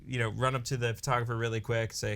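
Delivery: normal talking voice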